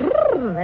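a cold lip-trill shiver; shivering brrr. Acted. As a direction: shudder from the winter cold. Guest arriving from the cold.

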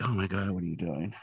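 hushed, far-off mock dismay at a partner; muttered oh-my-god at a colleague's antics; exasperated disbelief. Skinner silently reacting to Scully's testimony, hushed mock dismay. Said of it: "kinda doing that look that Scully usually does".

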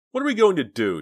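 high, loud wail of dismay. A narrator's reading. Dina wailing to Jade after losing the package. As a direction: cry it out in despair. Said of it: "Dina wailed".

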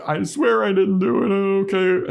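Dawn squeaked high and loud; pleading teen girl, voiced up high; high-pitched Dawn. Dawn protesting her innocence to the demon, high and pleading. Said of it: "Dawn's like".

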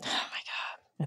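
slow stunned whisper. Real, not acted. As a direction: breathe it out in shock. Listener stunned by scene.

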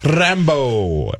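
Rambo with a rolled R; purring latin lover. Shown as a demonstration. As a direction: roll the R in Rambo. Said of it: "I just like rolling my R's".

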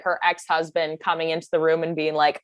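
usual speaking voice

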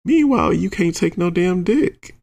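high, mocking complaint; exasperated scolding. Teller calling out a partner's false bravado, exasperated. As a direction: pitch the whole complaint up high in mock outrage.

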